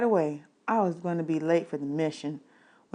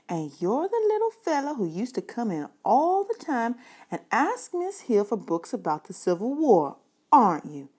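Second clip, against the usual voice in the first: a lightly higher lady's voice; librarian voice; 5 semitones higher than usual. Acted. Librarian remembering the boy's mother's books, lightly higher voice.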